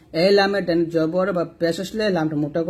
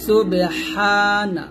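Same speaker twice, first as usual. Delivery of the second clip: ordinary talk, nothing marked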